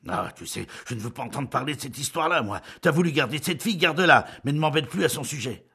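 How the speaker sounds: loud, fast angry husband; irritated outburst. A narrator's reading. Husband refusing to discuss it, angry. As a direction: snap back in annoyance. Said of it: "se fâcha".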